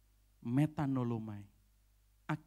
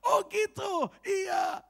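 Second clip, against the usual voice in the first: surprised; 8 dB louder, much slower than usual. Retold or imitated. Retold reaction of surprise.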